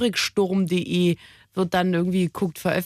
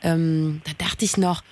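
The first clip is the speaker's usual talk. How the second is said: ordinary delivery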